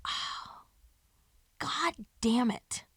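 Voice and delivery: slow whispered groan of regret; drawn-out whispered curse; whispered dismay. Retold or imitated. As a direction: breathe it out under your breath. Reaction to awkward news.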